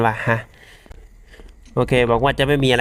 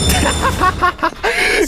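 laugh. Real, not acted. Laughing after game moment.

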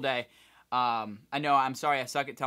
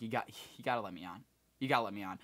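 quiet pleading; 6 semitones lower, 8 dB quieter than usual. Retold or imitated. Begging the gate manager.

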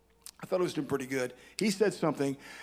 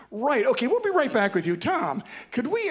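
higher and louder hurried host voice; flustered TV host; 7 semitones higher, 5 dB louder than usual. Retold or imitated. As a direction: cover the dead air like a host. Host covering the awkward silence.

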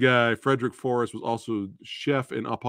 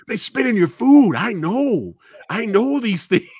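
higher, louder know-it-all voice; knowing movie quote; 9 semitones higher, 6 dB louder than usual. Retold or imitated. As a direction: sell it like an insider. Acting a character in the film.

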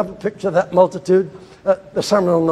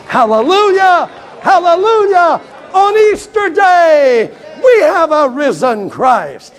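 high, loud shouted praise; preaching shout; 10 semitones higher, 5 dB louder, slower than usual. Real, not acted. Preacher's Easter climax, shouted. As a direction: preach it out at full force.

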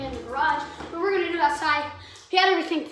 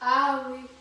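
ordinary talk

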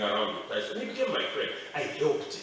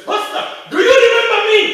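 loud, much higher question; loud call; 19 dB louder than usual. Acted. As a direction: call out across the church. Tyson greets old pastor.

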